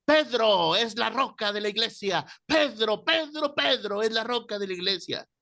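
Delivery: high, mock old man shouting his point; imitated heated insistence; retold insistent yell. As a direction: hammer the name Pedro like a stubborn old man. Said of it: "me gritaba el viejito".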